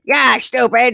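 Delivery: high, loud sassy judge voice. Pretend. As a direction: snap it like a TV judge. Hammed-up Judge Judy echo.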